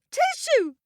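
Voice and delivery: huge, high, loud sneeze; giant sneeze. Acted. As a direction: sneeze it out as words. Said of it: "sneezed and coughed".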